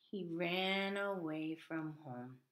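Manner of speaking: very slow, soft and hushed; sorrowful narration. A narrator's reading. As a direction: let each word sink sadly. Sad turn in the story.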